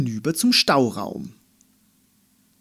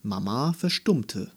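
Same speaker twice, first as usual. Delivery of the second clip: ordinary delivery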